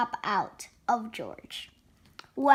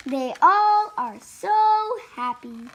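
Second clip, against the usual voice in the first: usual speaking voice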